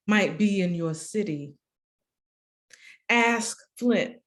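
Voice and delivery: measured spoken-word cadence with lifted, falling phrases; solemn, deliberate poem recital; poetry reading. Acted. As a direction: let each image land, pausing between lines. Reads own poem aloud.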